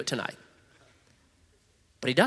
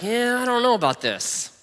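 hesitant Moses; 7 semitones higher than usual. Retold or imitated. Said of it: "he's like".

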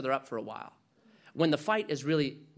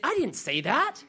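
wife objecting; 7 semitones higher than usual. Pretend. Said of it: "gives your wife a chance to say".

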